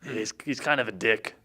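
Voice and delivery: usual speaking voice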